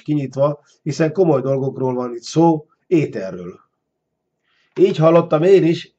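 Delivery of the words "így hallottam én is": raised, louder narrated cry; calling out. A narrator's reading. Man eagerly agrees with the stone giant, calling out. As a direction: call it up to the giant, higher and louder. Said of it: "kiáltotta Ármány".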